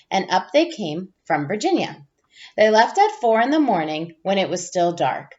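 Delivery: put-on gentle storybook read-aloud for young listeners; reading to children. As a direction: read it like a teacher sharing a picture book with the class. Reads picture book to class.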